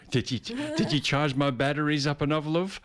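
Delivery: high, laughing mock whine of a neglected robot; whiny robot partner. Robot sulks at its owner for neglecting it, laughing mock whine. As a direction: pout like an undercharged gadget.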